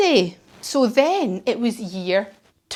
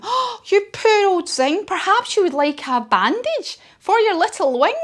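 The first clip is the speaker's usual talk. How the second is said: put-on little girl's voice; 9 semitones higher than usual. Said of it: "she says to him".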